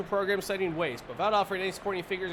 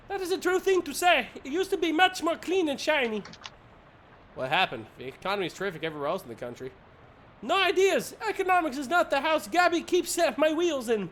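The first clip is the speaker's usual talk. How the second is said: high, louder put-on voice for Gabby; high character voice; 10 semitones higher, 5 dB louder than usual. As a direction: pitch it way up as the quirky sidekick.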